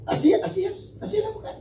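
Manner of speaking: mock high voice. Sudden high-pitched mock tone.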